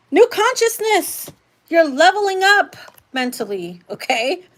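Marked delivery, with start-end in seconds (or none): 0.1-2.8 awed high voice. Real, not acted. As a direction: float the words up high in wonder. Tarot reader marvels at awakening.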